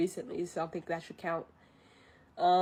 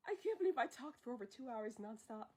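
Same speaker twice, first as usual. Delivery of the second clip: quiet; 14 dB quieter, faster than usual. Real, not acted. Exhausted murmur after cheering.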